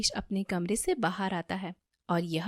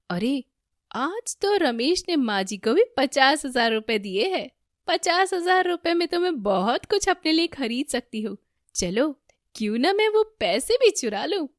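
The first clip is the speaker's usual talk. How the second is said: higher, louder excited plotting; gleeful scheming; 6 semitones higher, 5 dB louder than usual. A narrator's reading. Damini schemes to steal the fifty thousand, gleeful scheming. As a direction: plot the theft with rising excitement.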